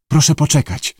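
plain reading voice

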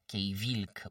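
usual speaking voice

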